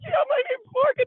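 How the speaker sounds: high, loud re-enacted introduction; excited retold reply.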